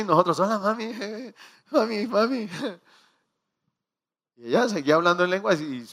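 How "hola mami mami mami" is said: gentle coaxing. Retold or imitated. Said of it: "le dice".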